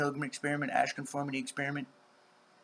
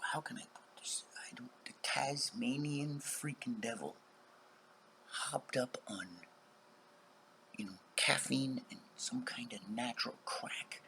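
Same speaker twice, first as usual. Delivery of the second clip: whispering; 2 semitones lower, 7 dB quieter than usual. Real, not acted. Warning viewers about angry females, hushed whisper. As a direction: lean in and whisper it like a secret. Confiding what females are like.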